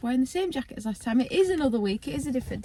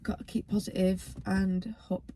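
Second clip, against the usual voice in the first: whispering; 3 semitones lower, 6 dB quieter than usual. Real, not acted. Quietly hoping, emotional.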